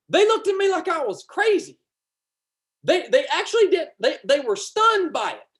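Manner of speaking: high-pitched, animated retelling; fired up. Real, not acted. Preacher telling how the jokers were stunned, fired up. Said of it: "I'm getting a little fired up".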